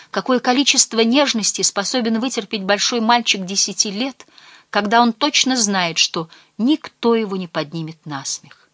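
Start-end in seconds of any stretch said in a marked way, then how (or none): none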